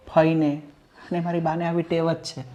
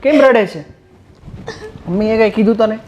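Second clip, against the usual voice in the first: higher, louder, alarmed questions; husband finding his crying wife, alarmed and louder; worried surprise; 6 semitones higher, 6 dB louder than usual. Acted. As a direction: rush in, alarmed at her tears.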